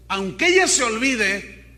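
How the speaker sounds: loud, ringing proclamation; emphatic scripture quote. Acted. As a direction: declare the verse forcefully. Vuelve a proclamar el versículo. (proclamación enfática a viva voz)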